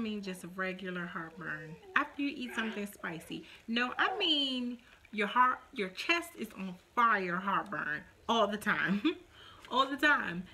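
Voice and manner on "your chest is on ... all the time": ordinary talk, nothing marked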